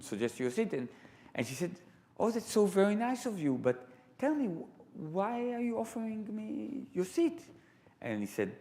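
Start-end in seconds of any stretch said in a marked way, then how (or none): 2.2-7.4 gentle mother. Retold or imitated. Mother voiced in story.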